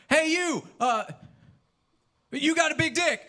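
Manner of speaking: high, loud mock yell; yelling out the window. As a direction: holler a compliment at a passerby. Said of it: "he loves to yell out the window".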